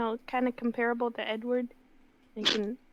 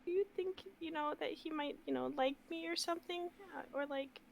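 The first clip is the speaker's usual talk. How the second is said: soft, halting, higher-pitched question; shy hesitancy; 5 semitones higher, 11 dB quieter than usual. Pretend. Smitten girl asking if the vampire might like her, shy. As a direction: ask it timidly, in small pieces.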